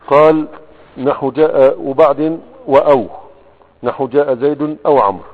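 loud, declaimed reading of a grammar text; formal text reading. Acted. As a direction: read the classical text out loudly. Reads the grammar text aloud. (قراءة المتن بصوت جهوري)

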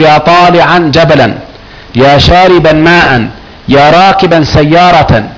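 slow examples, every noun ending on -an; "طالعا", "شاربا", "راكبا" with drawn-out -an endings; measured demonstration of -an in "جبلا", "ماء", "سيارة"; sounded tanween. Shown as a demonstration. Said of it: "الشبيه بالمضاف يبقى التنوين".

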